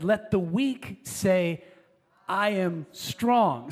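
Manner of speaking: very slow, every word weighed; slow, deliberate scripture quote; slow emphatic quote. Retold or imitated. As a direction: let each word land. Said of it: "the prophet Joel said".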